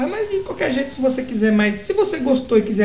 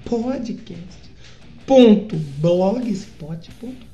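very slow, each syllable spelled out; slow it right down so they can write it; over enunciating; 3 semitones lower, much slower than usual. Shown as a demonstration. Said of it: "Vou falar devagar pra você entender".

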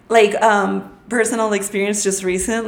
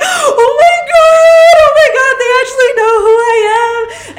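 much higher, loud, stretched excited squeal; thrilled squeal; 12 dB louder than usual. Retold or imitated. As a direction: scream it out starstruck.

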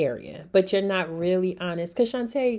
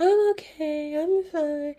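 high, singsong false reassurance; pretending to be fine; 7 semitones higher, slower than usual. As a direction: put on a high "all is well" voice. Acts out false front while depressed.

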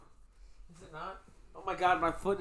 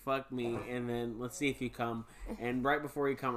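normal talking voice

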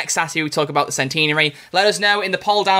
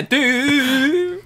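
twangy voice; 12 semitones higher than usual. Retold or imitated. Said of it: "a twang in your voice there".

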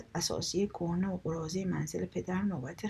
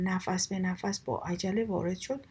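ordinary delivery

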